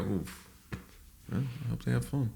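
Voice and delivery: very soft, deflated sigh of a line; reluctant self-imitation. Friend dreading the wedding day, soft reluctant mumble. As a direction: back out quietly, hardly any voice behind it. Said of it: "And I am somebody who goes".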